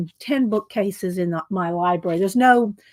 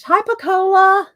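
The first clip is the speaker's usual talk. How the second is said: higher, louder bright hello; excited greeting; 9 semitones higher, 5 dB louder than usual. Real, not acted. Streamer welcomes viewer Pacola, excited and high. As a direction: call out a happy welcome.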